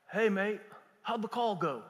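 light imitation of an English accent. English guy phones the preacher about the call, imitated English accent. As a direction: put on a casual English caller's voice. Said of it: "The English guy?".

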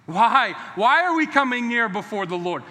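usual speaking voice

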